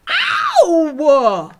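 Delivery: loud, high, drawn-out howl of pain; pained yell. A narrator's reading. Giant deafened by the girl, yelling in pain. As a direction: yelp as if your ear is hurting. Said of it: "yelled the B F G".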